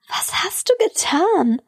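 high, slow, appalled question; beside herself. A narrator's reading. Woman confronting her boyfriend, beside herself. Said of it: "fragte Lucy außer sich".

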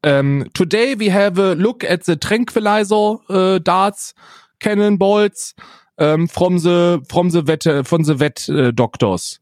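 put-on cheerful German-accented video host; Jörg Sprave impression. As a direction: present like a German YouTuber in German-accented English. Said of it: "ich muss in den deutschen Akzent reinkommen".

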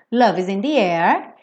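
normal talking voice

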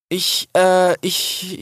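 high, stammered start; flustered. A narrator's reading. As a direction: search nervously for words. (verunsichert stammelnd)

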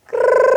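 one steady trilled ring; imitated phone ring. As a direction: trill like a telephone ringing. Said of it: "Plötzlich klingelte es".

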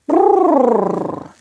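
long lip-trilled shake-off; dog shaking itself in disgust; shuddering brrr. Acted. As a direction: blow a high, rolling brrr. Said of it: "Auch ich musste mich schütteln".

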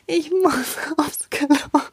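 breathless, frightened whisper with a nervous laugh; panicked whisper. Retold or imitated. Little girl whispering to the helpers that she needs the toilet, panicked whisper. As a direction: whisper it slowly like a panicked child. Panisch in den Augen.

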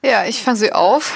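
usual speaking voice

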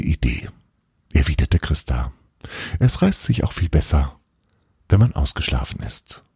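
ruhig vorgelesene Weihnachtsgeschichte; erzähl die Geschichte wie beim Vorlesen. A narrator's reading. Reads a Christmas story aloud. (Erzählerin liest Kindern eine Adventsgeschichte vor)

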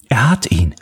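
breathy unvoiced whisper of relief; hushed gasp, he got him; whispered to herself in the car; relieved whisper. Catherine relieved, hears shots.

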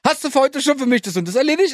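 hoch angesetzte, fordernde Frage; fragender Ton. Acted. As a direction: alles als Nachfrage formulieren, Stimme deutlich höher. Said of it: "Wenn wir so ein Fragen stellen".

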